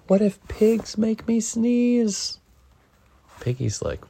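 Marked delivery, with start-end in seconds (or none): 0.1-2.4 slow, higher, anxious worry; worried. Acted. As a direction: dread dawning slowly. Gerald panics in story.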